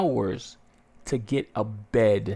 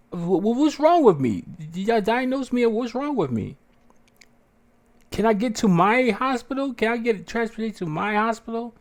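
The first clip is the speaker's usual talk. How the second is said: much higher, pressing questions; urgent demands; 11 semitones higher, 3 dB louder than usual. Retold or imitated. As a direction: insist on being transferred. Demanding answers from doctor.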